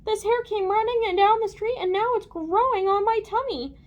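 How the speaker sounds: high, loud lady's voice; distressed lady. A narrator's reading. Lady tells Aaron about the hair, distressed. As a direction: wail the complaint in a high voice.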